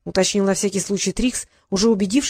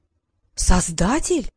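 awed; much slower than usual. Acted. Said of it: "восхитился Трикс".

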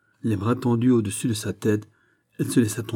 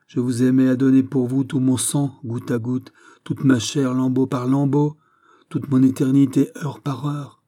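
raised, fervent declaration; impassioned spurned lover; 5 semitones higher, 7 dB louder than usual. A narrator's reading. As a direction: build a declaration phrase by phrase. (amant trahi, exalté et douloureux)

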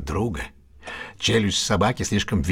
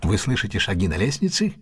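ordinary talk

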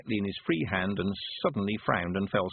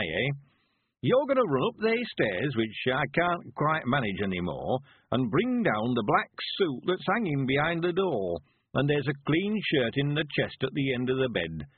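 put-on high-pitched rustic old-timer with swooping tune; lively, high old man's dialect voice; old country man, scheming cheerfully; 5 semitones higher than usual. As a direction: play an old villager laying out his plans. Narrator plays old Mister Weevil.

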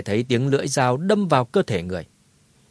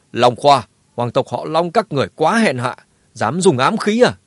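loud, rising furious demand; angry accusation; 2 semitones higher, 5 dB louder than usual. Acted. Thượng quan Nhu confronts Long Khoa over the hidden blade, furiously. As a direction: call him out in outrage. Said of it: "sát sầm mặt chất vấn".

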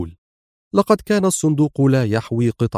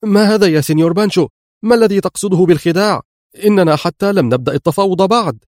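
higher, louder and fast, protesting; alarmed shout; 7 semitones higher, 4 dB louder than usual. Acted. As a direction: cry out in sudden alarm at the guns. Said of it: "تراجع في حدة هاتفا". (هتاف مذعور عالٍ وسريع)

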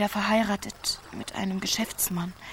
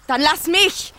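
shout; 7 semitones higher, 9 dB louder than usual. Acted. Shouted demand in struggle.

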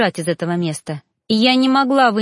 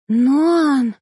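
higher, louder gasp of his name; 6 semitones higher, 4 dB louder than usual. A narrator's reading. Heroine gasping seeing her lover, shocked gasp. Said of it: "ахнула я".

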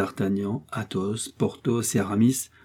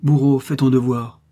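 loud, higher, commanding tone; firm command; 4 semitones higher, 7 dB louder than usual. A narrator's reading. Athos ordering the executioner to act, firm command. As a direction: give the order with authority.

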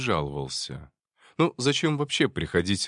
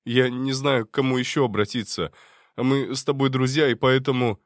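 frightened; 4 semitones higher, 5 dB louder than usual. Acted. Scared friend pleads.

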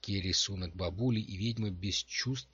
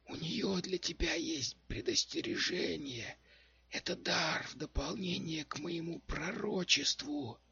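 high, slow crone voice, half-whispered; lightly whispered old witch warning; whispering old witch; 8 semitones higher than usual. A narrator's reading. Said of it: "прошептала бабуля". (шёпот старой ведьмы)

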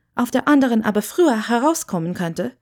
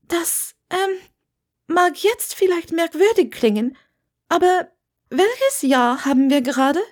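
put-on higher, hesitant young witch; Hermine, hesitant; 9 semitones higher than usual. A narrator's reading. Hermine introduces herself to Wendeline, high and unsure. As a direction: stumble a little, then give your name.

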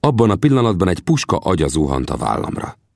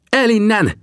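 high-pitched urgent cry; shouted warning. A narrator's reading. Sam urges companions to flee, high urgent shout. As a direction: call out high and strained to get away. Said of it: "kiáltotta Sam".

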